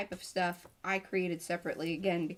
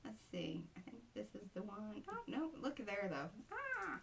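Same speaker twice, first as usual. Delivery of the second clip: talking around a pen; words muffled by a pen; pen in teeth; 10 dB quieter than usual. Real, not acted. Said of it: "I have my pen in my mouth".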